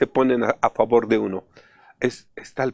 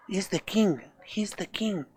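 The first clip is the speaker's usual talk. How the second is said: hushed whisper, fading to unvoiced; whispered gossip; 7 semitones higher, 10 dB quieter than usual. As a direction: whisper it behind your hand about the guest. Waiters whisper about the guest.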